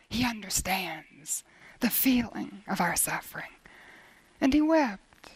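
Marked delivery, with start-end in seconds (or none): 0.1-3.5 hushed whisper. Real, not acted. Preacher whispering scripture to the congregation, hushed. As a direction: whisper the words, almost no voice.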